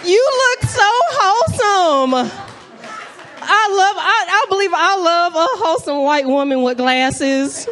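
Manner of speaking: high, loud gushing adoration. Real, not acted. Comic to lady in audience, high gushing adoration. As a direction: gush over her like a sweet grandma.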